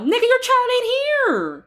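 a high-pitched incredulous retort; mock disbelief. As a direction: toss it back in disbelief. Acting out retort to stranger.

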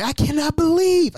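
high-pitched outraged cry; yelling. Retold or imitated. Nephew going off at his aunt on the phone, high retold yell. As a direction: let it leap up high in disbelief. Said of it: "and I'm yelling".

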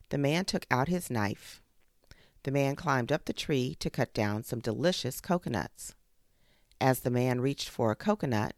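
ordinary delivery

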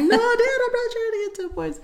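very high, whiny refusal; hammed-up daughterly exasperation; imitating protesting teen. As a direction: squeal a flustered no at dad. Acted teen protest to dad.

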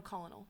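"Colonel" said as spelled, COL-uh-nel; three syllables in "Colonel", with the L sounded; mispronounced colonel. Real, not acted. Co-host insisting on own reading, "Colonel" said COL-uh-nel. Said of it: "how to pronounce this word".

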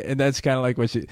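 normal talking voice

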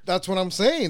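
ordinary talk, nothing marked

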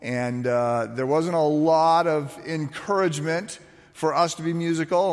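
ordinary talk, nothing marked

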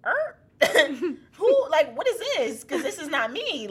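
high, loud mock bewilderment; startled confusion. Woman reacting to a mystery voice, comically startled. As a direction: squeal it, baffled and amused.

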